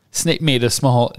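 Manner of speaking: ordinary delivery, nothing marked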